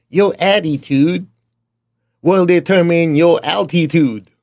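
put-on playful Southern accent quoting a sales motivator; Zig Ziglar Southern accent. Quoting an old sales motivator's catchphrase, hammed-up Southern accent. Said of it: "my Chinese version of a Southern accent".

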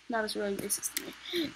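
soft unvoiced aside; whispering. Real, not acted. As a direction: whisper it as a joke.

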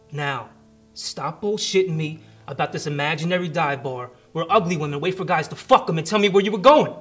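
building to a furious shout; tough cop. Acted. Cop loses patience, shouts demand.

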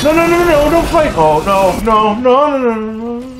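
high string of panicked cries; panicked pleading. Real, not acted. Player begs his troops don't fight, panicked high cries.

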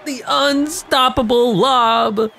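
high, slow shout of triumph; excited shout. Real, not acted. Gamer yelling as his lob play works. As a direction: yell it as the lob goes up.

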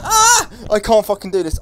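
piercingly high scream falling into loud protest; scream, then cry out in panic; real terrified scream. Real, not acted. Player giving up after a jump scare, screaming in terror.

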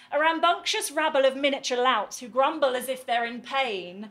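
loud, driving verse tirade; zealous poetry recital. Acted. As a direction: launch loudly into the rant with zeal. Recites real classroom with zeal.

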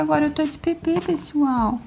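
voicing the baby.

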